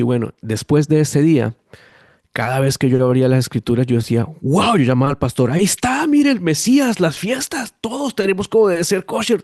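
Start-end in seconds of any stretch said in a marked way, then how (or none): 4.4-5.5 a much higher, amazed wow; thrilled exclamation while reading scripture; excited wow. Retold or imitated. 5.5-9.4 much higher, eager rush of discovery; excitedly calling the pastor about the Messiah and the feasts. Retold or imitated.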